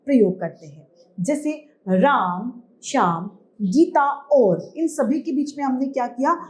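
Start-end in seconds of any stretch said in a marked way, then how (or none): 1.9-4.7 slow list with breaks after Ram, Shyam and Gita; short comma pauses. Shown as a demonstration. Teacher demonstrates commas to pupils, pausing between names. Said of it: "क्योंकि हमें कम रुकना था".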